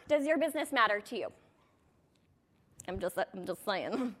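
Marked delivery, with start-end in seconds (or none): 2.9-4.1 lower, softer and slower, a sheepish aside; sheepish mumble. Real, not acted. Coach backs off her jab at the audience, mumbling. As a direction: back off the pointed question under her breath.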